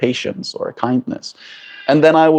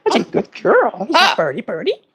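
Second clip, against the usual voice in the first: much higher coaxing pet voice; 10 semitones higher than usual. Real, not acted. Praising the pet bird.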